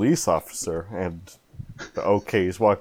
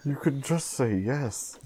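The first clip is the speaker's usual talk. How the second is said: quiet, slow urging aside; hushed prompting; 4 semitones higher, 9 dB quieter than usual. Acted. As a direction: coax him under your breath. Coaching friend under breath.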